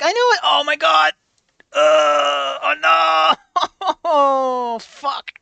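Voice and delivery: put-on high, shaky kid voice crying out in shock; a small boy whose world just cracked; distressed little boy. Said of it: "Malcolm's like".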